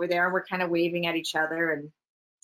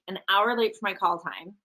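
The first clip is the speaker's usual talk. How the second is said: ordinary delivery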